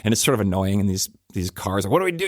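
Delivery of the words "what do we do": exasperated drivers. Retold or imitated. Drivers blocked by picketers.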